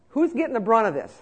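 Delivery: ordinary talk, nothing marked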